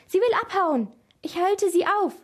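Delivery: high, excited shout in narration; urgent cry. A narrator's reading. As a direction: cry out that you are giving chase. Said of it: "schrie Maria".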